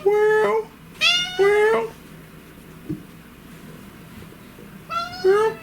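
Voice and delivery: very high imitated meow; cat meow. Owner meowing back to the cat, very high.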